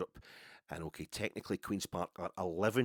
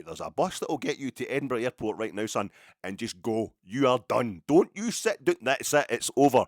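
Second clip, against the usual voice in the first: stern telling-off; 2 semitones higher, 9 dB louder than usual. Retold or imitated. Imagined manager sacking Philip.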